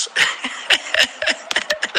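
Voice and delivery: breathy chuckle under the breath. Real, not acted. Laughing at own memory.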